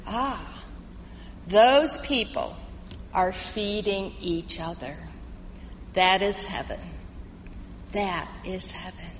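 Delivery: low, hushed revelation, with weighty pauses; understated divine voice, quietly solemn; voice of God. Acted. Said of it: "The voice says".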